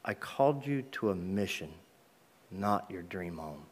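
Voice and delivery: a soft inner voice speaking a calling, slowed down; audible voice from God. Retold or imitated. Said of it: "I heard this audible voice say to me".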